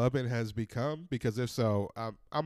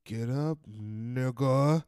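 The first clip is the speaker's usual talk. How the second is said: mock warning; slower than usual. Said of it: "look at me like".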